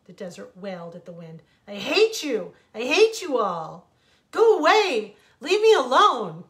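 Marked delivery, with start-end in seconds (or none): 1.7-6.4 much louder, higher screaming rage; furious shout. A narrator's reading. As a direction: scream them away. Desert's furious outburst at wind.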